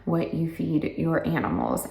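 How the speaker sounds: ordinary talk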